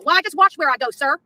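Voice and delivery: ordinary talk, nothing marked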